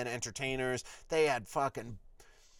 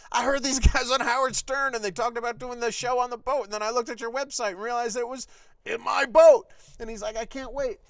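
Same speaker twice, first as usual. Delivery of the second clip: higher and louder fanboy rush; gushing excitement; 9 semitones higher, 8 dB louder than usual. Retold or imitated. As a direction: gush like a starstruck fan. Boat owner gushing about the band.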